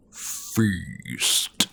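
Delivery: low guttural growl of a single word; deep guttural voice. Acted. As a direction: rumble it like something vast below. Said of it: "a deep guttural voice".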